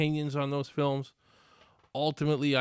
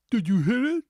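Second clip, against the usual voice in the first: louder, higher hammed-up demand; mocking movie dialogue; 9 semitones higher, 8 dB louder than usual. Repeating the trailer's cringe line, louder and mocking. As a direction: push the question, hammed-up and loud. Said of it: "Cringe-worthy dialogue".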